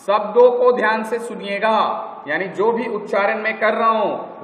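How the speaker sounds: ordinary delivery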